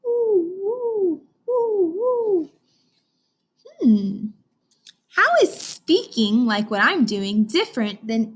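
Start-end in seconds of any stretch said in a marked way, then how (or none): 0.1-2.5 high, long hooting; spooky owl hoot. Shown as a demonstration. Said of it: "hoot like a spooky owl".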